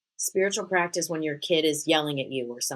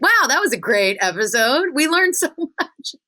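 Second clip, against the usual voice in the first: higher, louder hammed-up cheer with a laugh; mock self-praise; 10 semitones higher, 6 dB louder than usual. Host praising her own episode in a hammed-up cheer. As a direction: pat yourself on the back, laughing. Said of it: "So today I'm like".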